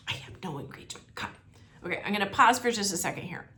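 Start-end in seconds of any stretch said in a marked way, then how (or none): 0.1-1.3 hushed, breathless insistence; a hushed push to go now; quiet insistence. Acted. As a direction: whisper it urgently, no arguing.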